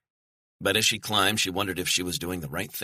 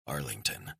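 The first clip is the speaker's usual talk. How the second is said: whispered; 7 semitones lower, 8 dB quieter than usual. Acted. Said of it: "whispered into his concealed microphone".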